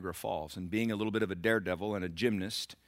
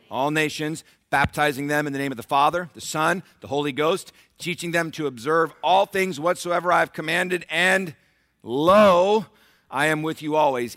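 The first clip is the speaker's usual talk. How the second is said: loud, raised proclamation; preaching scripture; 6 semitones higher, 10 dB louder than usual. Real, not acted. Preacher declaring the Great Commission to church, forceful proclamation. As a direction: herald the verse, hitting key words hard.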